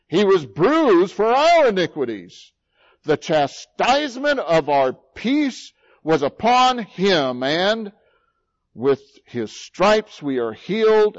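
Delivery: high, loud, slow preaching of the prophecy; fiery pulpit delivery, slowing on stripes and chastisement; preaching at full force. Acted. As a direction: proclaim each line like a wound laid bare.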